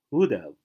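"would have" linked as "would-uv"; reduced have. Shown as a demonstration. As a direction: stress WOULD, let "have" shrink to a schwa. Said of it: "而读would have".

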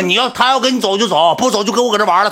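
the same words now yelled high and loud; angry repeat. Retold or imitated. To the fat guy, repeating in a high angry yell. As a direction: repeat it in a furious shout. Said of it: "我就急眼了".

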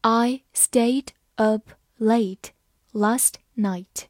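slow, with a gap after each word; slow read-aloud. Shown as a demonstration. Teacher leads learners through the example, slowly word by word. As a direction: spell out the sentence slowly, word by word. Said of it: "好，慢慢来读".

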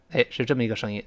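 normal talking voice

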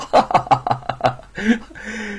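high, hearty laugh; triumphant laugh. Acted. Husband laughs at his successful trick, triumphant laughing. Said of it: "得意的大笑".

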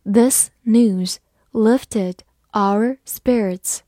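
very slow, word by word reading. Shown as a demonstration. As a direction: drag out each word for learners. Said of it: "好，慢慢来读".

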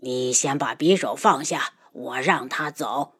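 low, soft, conciliatory; softened tone. A narrator's reading. Father negotiating with his daughter at knifepoint, softened. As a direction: back down and coax gently. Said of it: "语气软下来".

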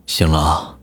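husky, low smoker's voice; hoarse from smoking. A narrator's reading. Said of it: "他的声音因为不知道抽了多少支烟，已经变得暗哑低沉".

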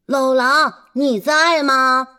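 calling out loudly to the wolf. Acted. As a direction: shout up to him. Piglets calling out again.

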